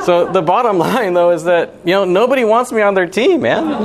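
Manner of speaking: laughing. Real, not acted. Laughing at his own rejection.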